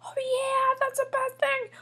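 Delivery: high shout of joy, wildly over the top; ecstatic outburst. Acted. Julian hears of the amusement park trip, ecstatic outburst. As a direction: explode with delight at the news.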